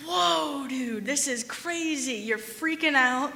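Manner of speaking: retelling how stunned he was, slow and high; a reenacted stunned plea to a friend; shocked protest. Retold argument, pushing back at friend.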